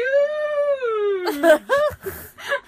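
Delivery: very high, loud held scream; shriek. A narrator's reading. Girl overwhelmed by the huge venue, shrieking. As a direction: scream at the top of your lungs. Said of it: "I shrieked at the top of my lungs".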